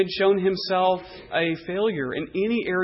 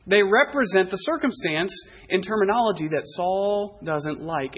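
ordinary delivery

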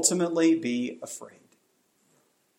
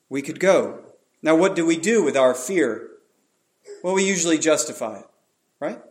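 ordinary talk

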